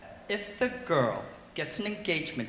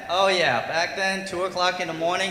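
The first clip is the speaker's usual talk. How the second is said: usual speaking voice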